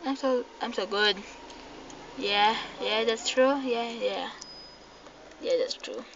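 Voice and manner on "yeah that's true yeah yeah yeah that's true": usual speaking voice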